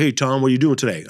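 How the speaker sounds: a hint of [name], quick and casual. [name] roommate greeting Tom, a light [name] voice. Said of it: "He was always [name]".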